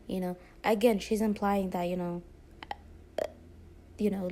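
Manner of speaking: ordinary talk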